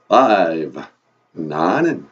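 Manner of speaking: low, loud vampire count. Acted. Count says the English numbers for the host, booming vampire voice. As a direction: announce the number like a theatrical vampire.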